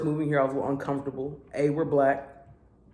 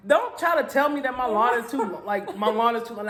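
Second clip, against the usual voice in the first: laughing mock defiance; 7 semitones higher than usual. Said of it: "I'm like".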